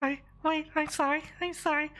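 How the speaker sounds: high squeaky frightened voice; pixie. Acted. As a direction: squeal and beg in a tiny voice. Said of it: "this person here is going".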